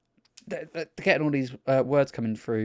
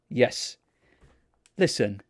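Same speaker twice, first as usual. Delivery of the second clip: normal talking voice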